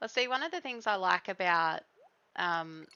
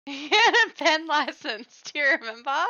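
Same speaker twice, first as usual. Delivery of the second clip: excited recall; 7 semitones higher, 9 dB louder than usual. Real, not acted.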